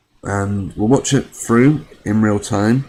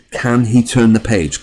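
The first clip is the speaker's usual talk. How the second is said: HE pressed louder, a teasing question; stress on he; 2 semitones higher, 5 dB louder than usual. Shown as a demonstration. Vlogger echoing the quote, pressing on he. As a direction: lean on "he" in "Can he turn the page?". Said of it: "Good question".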